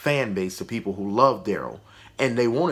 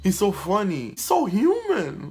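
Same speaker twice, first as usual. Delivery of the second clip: high-pitched fake gushing, climbing higher; mock gushing fans; 9 semitones higher than usual. Critic mocks fans won over by cheap jokes, high fake gushing. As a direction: gush in a squeaky parody of adoring fans. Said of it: "they want you to be like".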